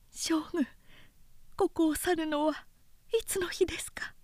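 high, hushed and anxious; hushed worry. A narrator's reading. Lady quietly asks her general when they leave. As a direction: ask softly, hiding fear. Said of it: "凶器の色を包んで".

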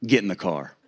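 low, quiet stern order; imitated stern father, softly commanding; no-nonsense dad. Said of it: "very no-nonsense, handle your business kind of guy".

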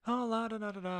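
high dismissive singsong la-da-da; distracted singsong. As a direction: tune out with a bored singsong. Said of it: "if you were talking to me and I would be like".

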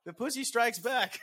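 high, slow punchline through laughter; real amused, drawn-out delivery; laughing. Real, not acted. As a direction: announce it while cracking up. Joke title said while laughing.

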